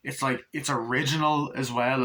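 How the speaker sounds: ordinary talk, nothing marked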